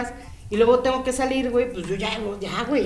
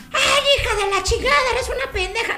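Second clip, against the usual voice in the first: high, raging mock scolding; imitating furious mom; 13 semitones higher than usual. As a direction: shriek the insult like an enraged mom. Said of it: "me agarró escobazos".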